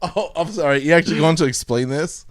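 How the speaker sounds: ordinary delivery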